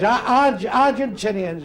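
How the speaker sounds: comic stutter on "Argentinians"; bad stutter. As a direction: stick on the first syllable, then drag the word out. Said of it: "One stutter very badly".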